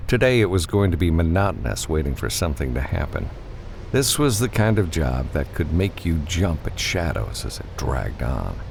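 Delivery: plain reading voice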